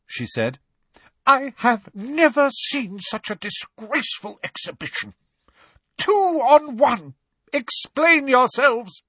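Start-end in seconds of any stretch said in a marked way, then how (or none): 1.3-9.0 furious. A narrator's reading. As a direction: scold them, shaking with rage. McGonagall quivering with rage.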